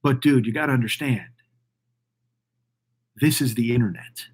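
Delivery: low, quiet and ominous, about the internet; a low, hushed grim warning to the streamer. Retold or imitated. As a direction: warn darkly of what's coming. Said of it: "everybody says ominously".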